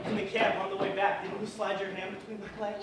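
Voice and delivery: loud furious taunt; angry accusation. Acted. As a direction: throw it in her face. Shouting accusation.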